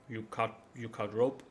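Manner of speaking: dim ogre asking loudly; rising, simple brute question; dopey cartoon bellow. Ogre asks about the rope.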